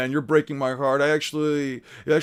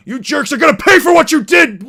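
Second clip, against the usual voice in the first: much louder, higher furious shout; raging Franky; 9 semitones higher, 10 dB louder than usual. Acted. Boss yelling at crew who wrecked his house, furious. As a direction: yell it like a wronged gang boss. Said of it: "there's that rage problem again".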